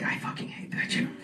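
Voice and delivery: bitter Ross employee whisper; muttered whisper. Acted. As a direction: hiss it under your breath. Ross workers grumbling.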